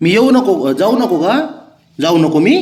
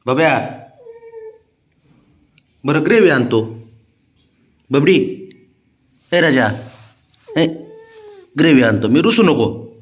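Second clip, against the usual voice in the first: higher, sing-song coaxing of a sulking pet; 6 semitones higher, 8 dB louder than usual. Real, not acted. Owner coaxing his sulking cat Babya before work, sing-song coaxing. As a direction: sweet-talk the cat so it lets you leave.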